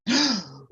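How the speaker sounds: sharp theatrical gasp, very high. Acted. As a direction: gasp like a startled lady. Felicity reacts.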